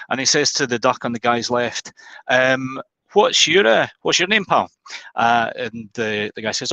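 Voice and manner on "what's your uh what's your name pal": higher Glaswegian barman asking a name. Retold or imitated. Barman asking the second duck, Glaswegian accent. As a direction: Glaswegian accent, friendly question. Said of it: "He's Glaswegian".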